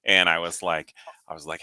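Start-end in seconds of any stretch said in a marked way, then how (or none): none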